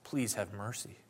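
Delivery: soft, hushed plea to God; pleading. Retold or imitated. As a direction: quietly beg for mercy on behalf of others. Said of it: "He pleaded with God".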